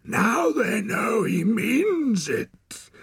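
slow, high, crazed voice; menacing wild man. A narrator's reading. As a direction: drive the threat home, drawing it out. Wild man's menacing character voice.

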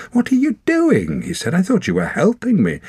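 shocked. A narrator's reading. Torak shocked at arrow on him.

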